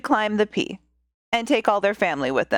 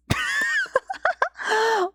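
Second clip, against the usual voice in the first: high burst of laughter; big laugh; 10 semitones higher than usual. Real, not acted. Storyteller laughing at her wrong character voice, real laughter. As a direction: crack up at your own slip.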